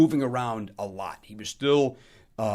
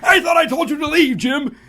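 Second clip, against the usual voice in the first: very high, very loud mock outrage; Don Rickles over the top; 11 dB louder than usual. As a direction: bellow it like an insult comic. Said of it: "in that Don Rickles over the top, wonderful way".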